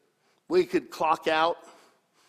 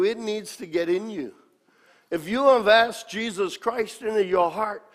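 ordinary talk, nothing marked

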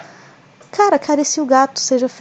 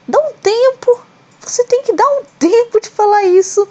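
much higher, fed-up, louder scoff; exasperated retort; 10 semitones higher, 4 dB louder than usual. Retold or imitated. Host mocking a coach's post, exasperated and scoffing. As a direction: snap back at the nonsense, irritated. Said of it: "eu fiquei assim, ó".

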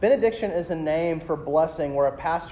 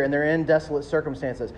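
ordinary delivery

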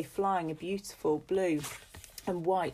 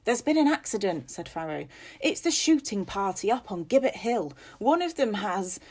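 louder, urgent report; shaken gamekeeper; 4 semitones higher, 4 dB louder than usual. Acted. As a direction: break bad news in a rush.

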